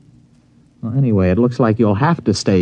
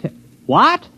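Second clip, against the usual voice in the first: startled; 12 semitones higher than usual. Acted. Stranger offered the farmer's daughter, startled high yelp. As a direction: cut himself off, jumping up high in pitch.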